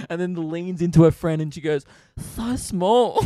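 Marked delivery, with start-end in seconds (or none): 2.2-3.2 high, louder put-on voice; imitated mocking girl. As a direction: mimic a woman gleefully judging to her friend. Girl to her friend, mocking.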